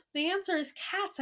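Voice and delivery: high-pitched triumphant announcement; lifted storybook shout; excited shout. A narrator's reading. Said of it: "I shouted".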